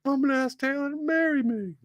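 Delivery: very high falsetto announcement; squeaky goofy boyfriend voice; hammed-up high-pitched declaration; mock proposing player. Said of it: "he's like".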